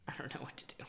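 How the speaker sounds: helpless whisper. Real, not acted. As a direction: whisper it, out of ideas. Stuck on types.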